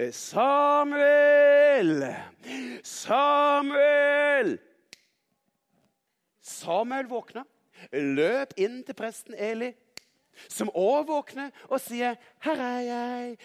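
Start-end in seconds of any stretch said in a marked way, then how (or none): 0.1-4.6 loud, high, long-held call; Gud calling out. A narrator's reading. Storyteller as the voice waking Samuel, loud drawn-out call. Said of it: "Gud som ropte på Samuel". 12.4-13.4 higher, held-out answer; Samuel answering. A narrator's reading. Woken boy reporting to the priest, high held-out answer.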